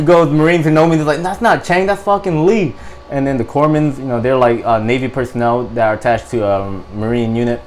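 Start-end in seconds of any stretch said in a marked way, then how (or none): 1.2-2.8 imitating buddies correcting someone, pitched high; lightly mock-indignant, higher voice; insistent correction. Marines who know him correcting the corpsman, insistent.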